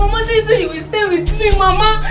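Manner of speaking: high, loud sobbing wail, begging her to wake; keen over her like your world just ended; wailing grief. Acted. Granddaughter begging her dead grandmother to wake up, sobbing and wailing.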